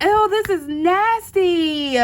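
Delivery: loud, slow, laughing mock disgust. Scrubbing the toilet, loud laughing disgust. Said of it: "I'm just like".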